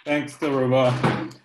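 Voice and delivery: ordinary delivery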